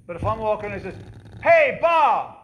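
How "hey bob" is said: loud, high hail at someone's back; calling out. Retold or imitated. Stranger hailing a man who keeps walking, loud high call. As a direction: shout a name down the street.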